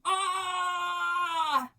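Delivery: high, loud startled scream; sudden frightened cry from the cabin; man scream. Acted. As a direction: yelp like a scared grown man. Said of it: "there came a man scream".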